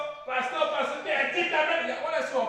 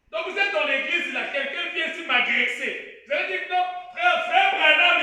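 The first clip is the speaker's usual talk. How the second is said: loud preacher cry; fired-up preaching; 5 semitones higher, 9 dB louder than usual. Acted. Preacher telling the church about an attacker, loud preaching. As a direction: preach it out hard to the hall.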